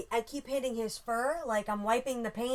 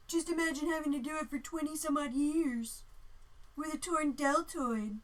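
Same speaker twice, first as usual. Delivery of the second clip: lightly voiced mad scientist; 6 semitones higher than usual. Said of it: "He's probably like".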